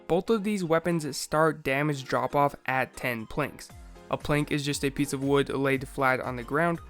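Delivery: ordinary talk, nothing marked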